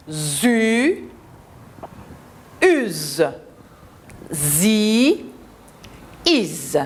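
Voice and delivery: ZA, AZ, ZO drawn out one by one; long vowels and buzzing z, a pause after each; teacher modelling isolated Z syllables; syllable drill. Shown as a demonstration. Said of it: "Je reprends".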